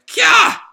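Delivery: short, loud anime squeal; high shriek. Acted. Rebecca pushed away from danger, high shriek. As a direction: shriek as if suddenly shoved.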